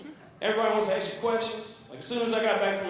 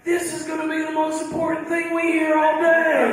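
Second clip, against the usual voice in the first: imitating impressed listeners; 5 semitones higher, 4 dB louder than usual. Comic imagining listeners wowed by an accent, mock awe. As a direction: play an awed crowd, pitched up and louder. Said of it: "y'all would be like".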